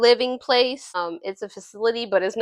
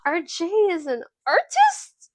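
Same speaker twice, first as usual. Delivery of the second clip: high, drawn-out theatrical surprise; mock amazement; 7 semitones higher, slower than usual. Podcaster revealing Jay is an artist, mock amazement. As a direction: feign astonishment, stretching it slowly.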